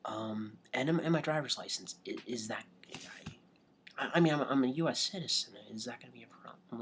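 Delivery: hushed, tentative, near-whispered appeal; sheepish plea. Retold or imitated. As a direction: plead very quietly and unsurely. Acting out sheepish plea to driver.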